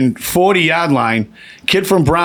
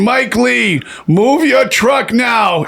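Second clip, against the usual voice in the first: stadium announcement; 9 semitones higher, much slower than usual. Retold or imitated. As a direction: announce it across the stadium, slowly. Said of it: "they're saying".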